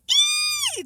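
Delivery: very high squealed shriek. A narrator's reading. Tootsie joining the breakfast uproar, shrieking. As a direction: squeal "ee" right at the top of the voice. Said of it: "Tootsie shrieked".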